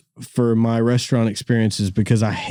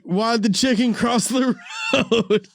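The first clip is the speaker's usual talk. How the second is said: joke through laughter; 9 semitones higher than usual. Retold or imitated. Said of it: "I was like".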